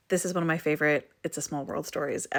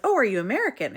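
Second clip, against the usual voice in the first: pitched-up, louder quoted question; surprised question; 6 semitones higher, 5 dB louder, faster than usual. Retold or imitated. Stranger on a night train meets a fellow traveler, mock surprise. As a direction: imitate a pleasantly surprised stranger asking.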